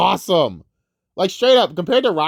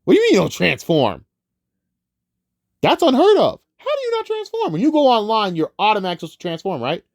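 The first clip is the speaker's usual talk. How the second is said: rising, baffled disbelief, pitch climbing high; confused Alpha Trion impression; 7 semitones higher than usual. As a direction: play an old robot who can't believe what he hears. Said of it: "that's his impression".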